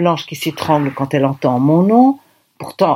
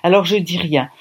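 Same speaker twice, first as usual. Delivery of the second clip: plain reading voice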